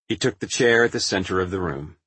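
ordinary delivery, nothing marked

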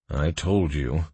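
ordinary delivery, nothing marked

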